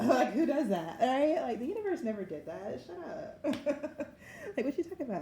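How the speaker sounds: loud, high, laughing incredulity; cracking up at how silly it is; laughing disbelief. Real, not acted. Incredulous laughing rant at idea.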